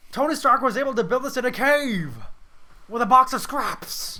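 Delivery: high, loud outburst of rage; hammed-up angry yell, slowing at the end; angry movie quote. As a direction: shout it like a furious boss. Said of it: "he's so angry".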